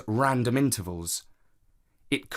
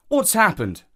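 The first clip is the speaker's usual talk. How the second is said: higher, louder startled question; raised-voice concern on finding them upset; alarmed question; 8 semitones higher, 5 dB louder than usual. A narrator's reading. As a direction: ask it urgently, pitched up. Uncle finds children in tears.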